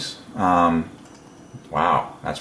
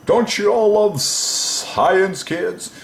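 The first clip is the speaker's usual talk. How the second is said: high-pitched, drawn-out S in science; hammed-up children's teacher; 11 semitones higher, much slower than usual. Entertainer asking kids about science, hammed-up high voice. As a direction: sing out slowly to the kids, much higher. Hammed-up address to imagined class.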